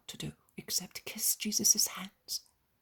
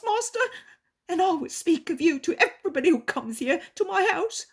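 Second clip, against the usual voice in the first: higher, louder desperate plea; pleading innkeeper; 11 semitones higher, 11 dB louder than usual. A narrator's reading. Innkeeper begs Jesus to heal his daughter, pleading. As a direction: beg the master for your daughter.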